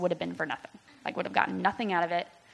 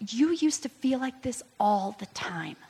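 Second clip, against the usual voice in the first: higher whisper, nearly toneless; 4 semitones higher than usual. Retold or imitated. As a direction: breathe it out gently. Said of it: "He's like".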